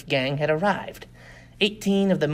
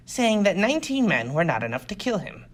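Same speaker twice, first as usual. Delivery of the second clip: plain reading voice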